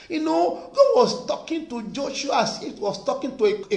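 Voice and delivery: ordinary talk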